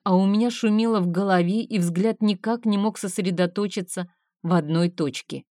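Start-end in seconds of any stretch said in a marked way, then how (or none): none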